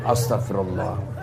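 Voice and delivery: quiet startled prayer at a temptation; hushed istighfar. Shown as a demonstration. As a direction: murmur it to yourself. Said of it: "kau nafsu, astagfirullah".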